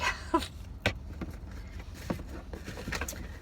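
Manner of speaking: soft laugh through tears; small choked laugh while emotional; teary breathy laugh. Real, not acted. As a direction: let a tearful laugh slip out.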